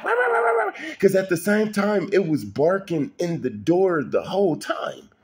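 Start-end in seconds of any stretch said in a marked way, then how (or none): 0.0-0.7 very high yapping rah rah; yappy bark imitation. Imitating the little poodle charging the big dogs, a very high yappy bark. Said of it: "It ran and it was like".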